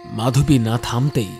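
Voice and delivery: ordinary delivery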